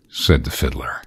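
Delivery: ordinary delivery, nothing marked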